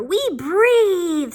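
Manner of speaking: high, loud dragon roar of a word; dragon father. Acted. Dragon father to his little dragons, roaring. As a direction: blast it out like a dragon.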